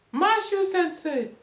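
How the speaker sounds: high, drawn-out call of the name; high slow call; calling the teacher. Retold or imitated. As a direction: imitate students calling out a name.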